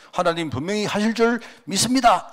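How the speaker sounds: declaring it as if God must comply; forceful confident claim. Retold or imitated. Preacher imitating a believer forcing God's hand, mock-insistent. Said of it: "강제성으로 느끼지".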